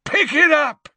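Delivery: high, loud furious bellow. Acted. Father ordering his clumsy daughter, bellowing. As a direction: roar the order out. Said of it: "Gaunt bellowed at her".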